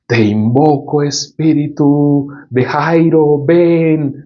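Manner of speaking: high, drawn-out mock invocation; séance sorcerer. Movie sorcerer calling up Jairo's spirit, mock chanting. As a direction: imitate a movie sorcerer summoning a spirit, vowels held long. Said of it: "los brujos hacen así como sesiones de espiritismo".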